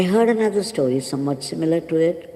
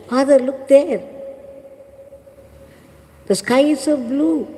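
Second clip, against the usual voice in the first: excited shout; 8 semitones higher than usual. Retold or imitated. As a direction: call to father, thrilled by the sky. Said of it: "looked at the sky and shouted".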